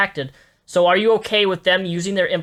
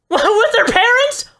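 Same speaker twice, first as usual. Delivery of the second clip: incredulous laugh; 6 dB louder, slower than usual. Real, not acted. Shocked laugh at clip.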